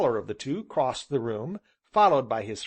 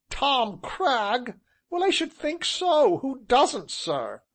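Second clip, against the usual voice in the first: high, loud amused disbelief; amused scoff; 12 semitones higher, 4 dB louder, slower than usual. Acted. Tall gentleman amused at the question. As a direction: scoff cheerfully.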